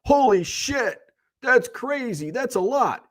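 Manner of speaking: high, astonished gasp of a listener; amazed listener. Retold or imitated. Viewer reacting to the numbers, high astonished. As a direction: play the wowed viewer shocked at the numbers. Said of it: "one person listening can be like".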